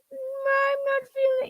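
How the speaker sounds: very high, thin frail Pope voice; squeaky Pope voice. Acted. Son dubs the dying Pope for his mom. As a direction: squeak it out like a sickly old Pope. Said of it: "he goes".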